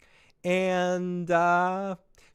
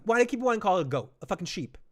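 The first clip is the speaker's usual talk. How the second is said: ordinary delivery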